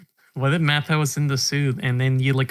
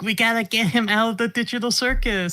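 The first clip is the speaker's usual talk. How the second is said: mock Ty voice; 9 semitones higher than usual. Ty voiced unplugging Grandpa.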